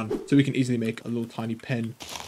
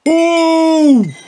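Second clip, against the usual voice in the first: sudden high yell of triumph; excited shout; 12 dB louder than usual. Real, not acted. Gamer cracking open a chest, triumphant shout. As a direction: shout it like you just struck gold. Chest opened, hoping for diamond.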